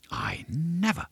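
soft, high, breathless whisper. A narrator's reading. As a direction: whisper in disbelief. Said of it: "she whispered".

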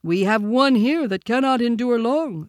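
high, loud bear voice; Baloo the bear, concerned. A narrator's reading. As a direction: say it as the worried old bear.

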